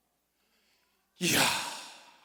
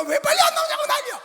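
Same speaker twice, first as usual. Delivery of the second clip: high laughing yell; laughing shout; 12 dB louder than usual. Retold or imitated. Pastor laughing about his wife's fuss, loud laughing shout. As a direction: burst out laughing while shouting.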